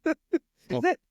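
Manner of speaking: high, mocking phone voice; customer service voice. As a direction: echo the phone voice back high. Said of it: "the only fans phone voice".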